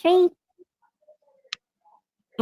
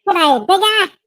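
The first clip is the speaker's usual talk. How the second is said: high-pitched Australian greeting; 9 semitones higher than usual. Shown as a demonstration. Showing the listener his Australian voice, mock Australian accent. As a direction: put on a high, hammed-up Aussie accent. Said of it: "here's my Australian voice".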